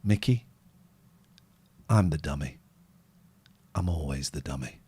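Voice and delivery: low, soft resigned voice. Retold or imitated. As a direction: say it low and sad, giving up. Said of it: "And [name] goes".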